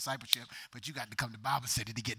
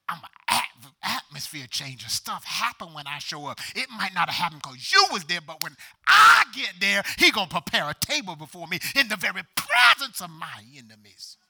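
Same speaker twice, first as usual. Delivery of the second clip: louder, building declaration; preaching at full force; 5 semitones higher, 14 dB louder than usual. Real, not acted. Pastor proclaiming to the church, rising force.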